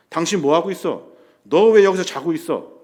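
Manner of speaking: loud accusing retold shout; accusing outburst. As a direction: hurl the blame at him. Disciples accuse sleeping Jesus.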